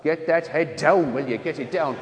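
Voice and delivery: mock-stern rugby nun; nun coaching rugby. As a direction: imitate a bossy sister ordering lads about. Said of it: "she got this umbrella".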